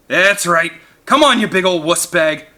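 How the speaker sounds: loud taunting holler. A narrator's reading. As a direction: bait the beast, loud and cocky. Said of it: "Paul hollered".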